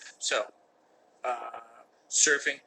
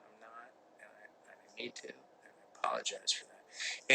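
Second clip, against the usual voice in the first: whispered apology; 10 dB quieter than usual. Real, not acted. Apology trailing off.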